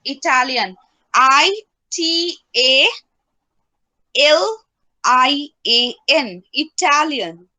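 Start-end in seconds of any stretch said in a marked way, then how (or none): 1.1-6.4 letters spelled slowly and loud; spelling it out. Shown as a demonstration. Teacher spelling Italian for a student, slowly. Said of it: "স্পেলিংটা বলো Italian".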